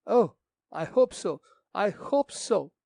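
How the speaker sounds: eager hope. Acted. Daughter hopes father alive.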